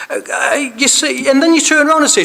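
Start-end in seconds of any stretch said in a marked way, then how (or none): none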